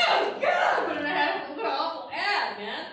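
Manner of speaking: high disgusted oh God; appalled mock reaction; disgusted groan. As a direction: recoil at something gross.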